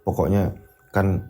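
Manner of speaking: timid wife. Acted. Wife phoning her husband about money, timid. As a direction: ask him nervously.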